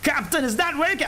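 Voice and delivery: high, quick mock engineer; panicked ship's engineer. Engineer asking the captain if it works, high quick mock panic. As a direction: rush it high, hammed-up worry. Same engineer spoof continues.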